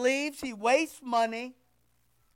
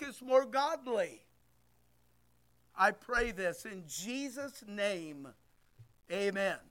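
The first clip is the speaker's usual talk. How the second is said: prayer; 5 dB quieter than usual. Acted. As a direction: pray earnestly and quietly for the room. Leads the congregation in prayer.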